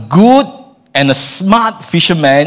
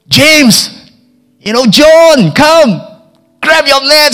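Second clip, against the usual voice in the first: high, carrying call to the other boat; 10 semitones higher, 4 dB louder, much slower than usual. Retold or imitated. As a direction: hail your mates across the water. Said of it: "they start yelling out".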